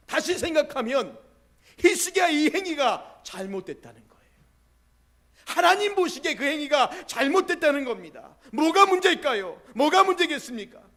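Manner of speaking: loud, high, ringing sermon voice; full-force preaching. Real, not acted. Pastor challenges the congregation about answered prayer, loud preaching. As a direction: preach it out to the whole hall.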